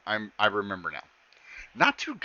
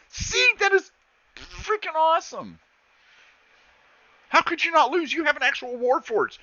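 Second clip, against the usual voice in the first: much higher, gushing awe; excited gushing; 12 semitones higher than usual. Acted. The girl gushes to Max, much higher and excited. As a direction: gush over the robot like a fan.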